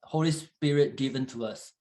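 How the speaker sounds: ordinary delivery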